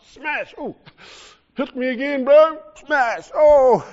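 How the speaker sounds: high, loud mock cries of a man being struck; acted beating. Preacher acting Paul being lashed, mock pained cries. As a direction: play Paul taking the blows.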